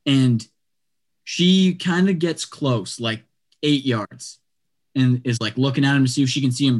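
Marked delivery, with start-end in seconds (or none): none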